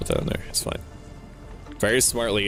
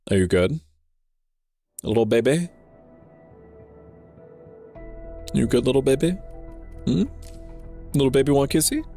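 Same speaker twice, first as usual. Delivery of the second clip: cute anime streamer; 5 semitones higher than usual. Pretend. Teasing viewer in baby talk.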